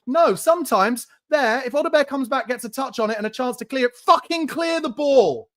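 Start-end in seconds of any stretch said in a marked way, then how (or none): 4.0-5.5 slow, high, furious yell; fed-up fan yelling at his team; exasperated yell. Real, not acted. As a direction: spit it out at the screen, drawn out. Furious at defender's failure.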